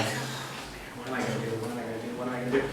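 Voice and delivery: low, soft worried repeating; hushed frantic self-talk; panicked mutter. Retold or imitated. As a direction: mutter it to yourself in quiet panic. Panicked inner monologue while digging.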